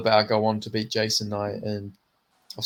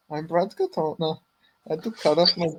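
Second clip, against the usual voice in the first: a hint of a mixed Canadian-Irish accent, pitched up; a failed try at a Canadian-Irish accent; half-hearted Katona accent; 5 semitones higher than usual. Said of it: "I can't even do the accent".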